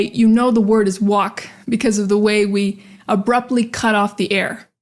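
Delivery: ordinary talk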